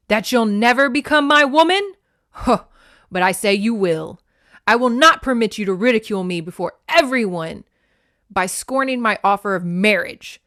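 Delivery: loud, forceful, scornful declaration; sneering anger. A narrator's reading. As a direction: storm at her as a spurned warrior. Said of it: "he sneered".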